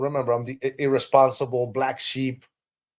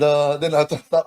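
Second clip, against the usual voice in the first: usual speaking voice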